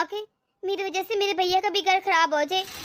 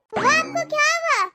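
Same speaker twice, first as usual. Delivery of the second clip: put-on higher, slow little girl asking her aunt; small child voice; 6 semitones higher, 3 dB louder than usual. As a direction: play a curious little niece. Little niece asks her aunt.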